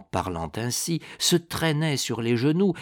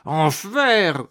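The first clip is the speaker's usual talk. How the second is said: loud, raised, drawn-out oath; growled curse; 6 semitones higher, 6 dB louder than usual. A narrator's reading. The monk backs away from the dagger, growling an oath. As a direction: curse in thwarted fury. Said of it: "gronda-t-il".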